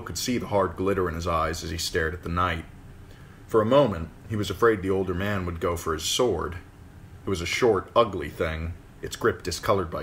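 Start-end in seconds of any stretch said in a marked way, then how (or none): none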